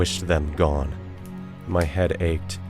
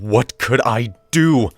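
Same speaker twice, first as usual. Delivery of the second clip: slow, raised, anguished plea; desperate cry; 4 semitones higher, 5 dB louder than usual. Acted. Narrator's desperate cry to God.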